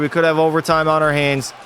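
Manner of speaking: normal talking voice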